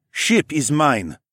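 slow, firm alien man. A narrator's reading. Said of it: "firmly interrupted".

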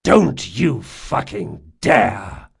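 slow, loud, emphatic warning; furious warning. A narrator's reading. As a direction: hit each word hard, in anger. Warning repeated with more force.

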